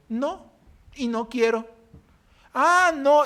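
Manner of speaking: high, sharp defiant no; young woman refusing, then pastor flaring; retold defiance. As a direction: snap back defiantly. Retold quarrel with the girl.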